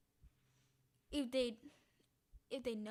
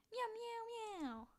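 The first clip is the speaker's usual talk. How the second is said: high soft meows; little contented kitten mews; cat meows; 10 semitones higher, 4 dB quieter, much slower than usual. Acted. Voicing the cat eating.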